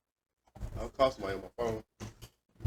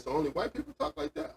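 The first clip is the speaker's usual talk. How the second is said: mock indignant question; 4 dB quieter than usual. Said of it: "I said".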